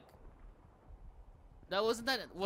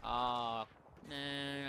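ordinary talk

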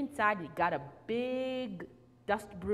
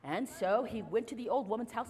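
usual speaking voice

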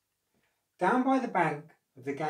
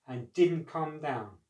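plain reading voice

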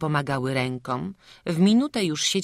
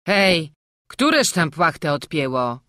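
loud call into the wagon; 2 semitones higher, 9 dB louder than usual. A narrator's reading. Father calling into the wagon, loud and demanding. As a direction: shout up to the sleepers.

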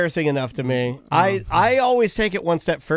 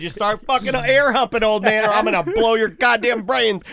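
mock gunman threat; 3 semitones higher than usual. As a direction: stretch out the threat for comic fury. Acted gunman threatening old man.

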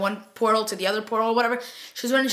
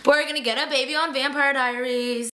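higher, thrilled fan reaction; excited announcement; 4 semitones higher than usual. Retold or imitated. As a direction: burst out with joyful news. Celebrating the pregnancy news.

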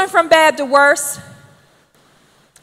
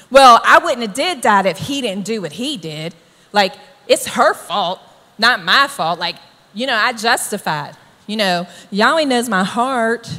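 lower-pitched excuse-making; self-justifying; 5 semitones lower than usual. Acted. Sinner blaming someone else for the sin, self-justifying. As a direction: shift the blame in a low defensive voice. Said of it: "to justifying your sin".

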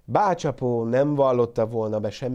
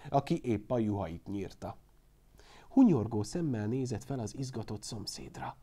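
plain reading voice

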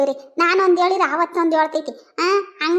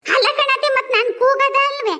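raised voice to deaf listener; 6 semitones higher, 4 dB louder than usual. Acted. Correcting deaf mother-in-law loudly.

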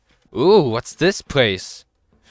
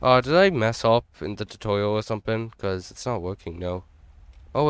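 ordinary talk

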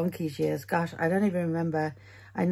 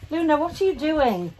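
calling the dog; 9 semitones higher than usual. Real, not acted.

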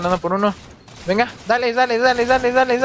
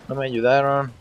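usual speaking voice

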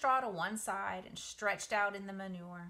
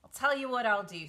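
rat voice; 3 semitones higher, 8 dB louder than usual. Acted. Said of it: "said Templeton".

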